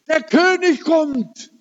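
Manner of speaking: very high, thrilled cry; excited retelling. Retold or imitated. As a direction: imitate her excited announcement at the top of the voice's range. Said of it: "ganz aufgeregt".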